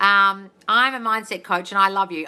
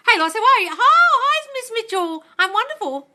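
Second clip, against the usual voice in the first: high, loud, sing-song hello; excited greeting; 8 dB louder than usual. Real, not acted. Greeting Miss Mitchell on the live, a high loud cheerful hello.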